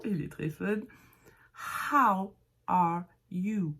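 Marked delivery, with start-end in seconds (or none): 1.9-3.7 «How are you» slow, each word apart, breathed H; separated words. Shown as a demonstration. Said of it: "On commence par bien séparer".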